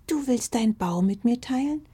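very high, panting fox-cub voice; a little fox amazed by the invitation; surprised little fox. Acted. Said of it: "hechelte die kleine blaue Füchsin überrascht". (sehr hohe, überraschte Fuchsstimme)

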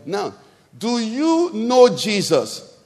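ordinary talk

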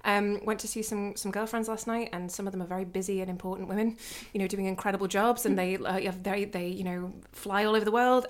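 ordinary talk

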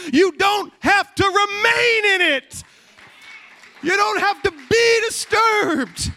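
loud, fervent preacher building up; full-force sermon climax; preaching at full force. Acted. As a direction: preach it hard to the congregation.

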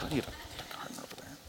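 breathy unvoiced whisper; hushed mock question; whispered aside.

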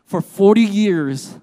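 slow, loud try at a Southern drawl; attempted Southern accent. Preacher retrying Red's line after apologizing, attempted Southern accent. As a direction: imitate a Southern movie narrator, stretched out.

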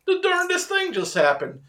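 high-pitched baffled hillbilly; put-on high hillbilly voice; Hillbilly Jake. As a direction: report it like an astonished yokel. Voicing Hillbilly Jake reporting the woods.

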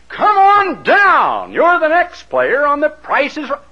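high, loud announcer summons, stretched at the start; game show announcer. Retold or imitated. Announcer calling Martha down to play, game show shout. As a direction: summon the lucky contestant with full TV hype. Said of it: "when this announcer".

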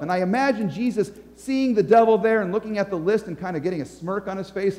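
ordinary talk

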